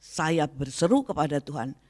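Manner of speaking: ordinary talk, nothing marked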